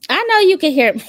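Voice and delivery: loud call to the sister in the next room. Real, not acted. Telling her sister she can hear, loud call. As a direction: raise it so she hears through the wall.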